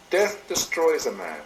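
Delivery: ordinary talk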